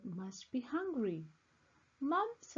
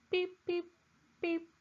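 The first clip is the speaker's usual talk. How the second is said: high, squeaky little peeps; chick peeping; 8 semitones higher than usual. Acted. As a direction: chirp it like a tiny chick. Children hear a baby bird.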